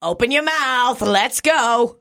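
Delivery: high, drawn-out hearty command; Jerome. Retold or imitated. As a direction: imitate a spunky coworker calling out. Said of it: "Jerome did say".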